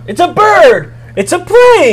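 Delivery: excited shout. Acted. Repeating the famous cry.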